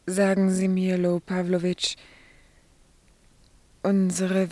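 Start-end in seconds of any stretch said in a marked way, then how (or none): none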